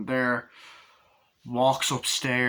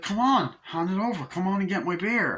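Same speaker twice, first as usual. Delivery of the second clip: higher-pitched, mildly whiny demand; pushy retold plea; mock pestering; 7 semitones higher than usual. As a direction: imitate someone nagging to get their way. Retelling a character's pestering demand.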